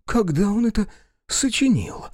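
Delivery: high-pitched envious question; envious, wondering pitch jump. Acted. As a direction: ask it high, with envy. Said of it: "Шарлатан с завистью".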